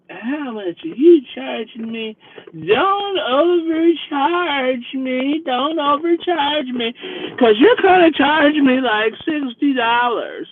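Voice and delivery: put-on high, slow, slurred drunk voice; suspicious drunk woman. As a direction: drag it out like a wary drunk. Drunk woman worried about fare.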